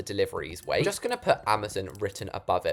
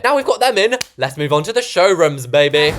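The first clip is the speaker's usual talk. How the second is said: excited; 5 semitones higher, 9 dB louder than usual. Real, not acted. Excited announcement.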